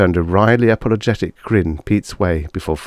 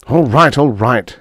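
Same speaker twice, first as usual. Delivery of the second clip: raised snarl, dropping on the repeat; 6 semitones higher, 5 dB louder than usual. A narrator's reading. Husband gives in to his wife, snarling. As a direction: give in with irritation. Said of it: "snarled Corey".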